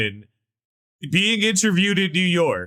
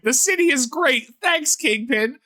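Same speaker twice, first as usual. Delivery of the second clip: high-pitched fake enthusiasm; mock cheerful; 8 semitones higher than usual. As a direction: gush like a planted interviewee, mockingly. Mocking a staged happy interview.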